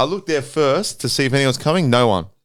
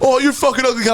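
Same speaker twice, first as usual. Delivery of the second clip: mocking retort; 8 semitones higher, faster than usual. Mimicking driver's retort.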